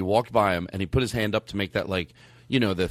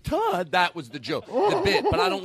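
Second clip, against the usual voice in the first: incredulous friends; 14 semitones higher, slower than usual. Retold or imitated. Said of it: "People are like".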